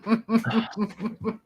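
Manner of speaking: a high, loud burst of laughter around a flat nope; laughing nope. Real, not acted. Laughing as he rejects a non-ginger version of his hero. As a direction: laugh it off, then nope through the laugh.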